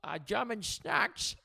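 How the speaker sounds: high, very slow mock German; German accent. Shown as a demonstration. Host given German to do, in a slow mock German accent. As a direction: stretch it out in a silly German accent. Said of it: "All right, German".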